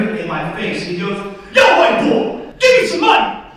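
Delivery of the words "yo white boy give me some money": loud, high-pitched demand; acted angry holler at a victim; aggressive mugger. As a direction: shout it like a street robber.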